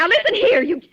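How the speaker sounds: high, loud start of a tirade; furious scolding. Acted. Angry invalid about to berate the operator, loud and high. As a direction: wind up to tear into her.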